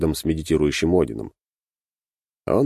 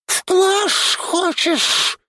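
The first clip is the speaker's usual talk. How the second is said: very high, drawn-out creature hiss; sinister hiss; much slower than usual. A narrator's reading. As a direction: hiss the threat slowly. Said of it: "зловеще прошипел Шнырька".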